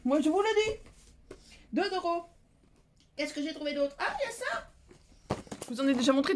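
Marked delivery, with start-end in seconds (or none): 0.0-1.4 drawn-out mock warning. Mock warning about men. 4.0-5.4 high drawn-out "ah"; squeaky slow surprise. Acted. Acted discovery.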